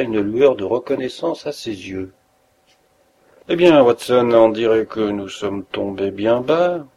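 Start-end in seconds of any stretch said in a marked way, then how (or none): none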